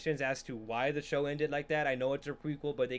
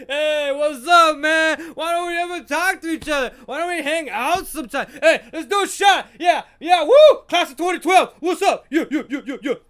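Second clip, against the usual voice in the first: loud, high, whooping party bro; drunk jock; 11 semitones higher, 14 dB louder than usual. Acted. Drunk jock cornering you at a party, shouting. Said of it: "drunk jock at a party".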